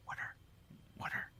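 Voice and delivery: very soft, barely voiced teasing echo; mimicked murmur. Classmates echoing his word to tease him, hushed mimicry. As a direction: murmur it under the breath like a mocking echo. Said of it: "would, like, say".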